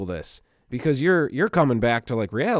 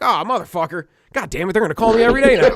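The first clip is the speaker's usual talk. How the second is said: mock exasperation; 9 semitones higher, 6 dB louder, faster than usual. Retold reaction to spam calls.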